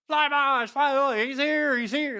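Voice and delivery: high, loud squealing excitement; excited flyboys. Retold or imitated. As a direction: squeal that he's arrived. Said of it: "they're all like".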